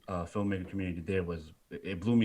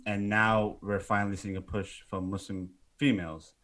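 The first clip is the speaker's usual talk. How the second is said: ordinary talk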